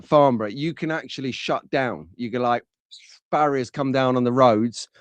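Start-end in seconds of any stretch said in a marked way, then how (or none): none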